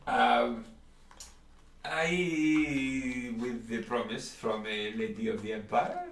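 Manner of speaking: a fussy shopkeeper warning customers off his stock. Acted. Shopkeeper warning the players off the bottle, high and polite.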